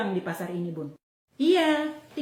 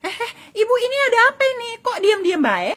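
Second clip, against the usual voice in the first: much higher, alarmed butting in; surprised high interruption; 12 semitones higher, 5 dB louder, faster than usual. Acted. Bystander interrupts two quarreling mothers, high and alarmed. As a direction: jump in startled at the fight.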